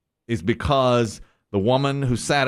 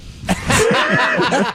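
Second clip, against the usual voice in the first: high, loud burst of laughter; cracking up; sudden squealing laugh; 5 dB louder than usual. Real, not acted. Big laugh at being recognized.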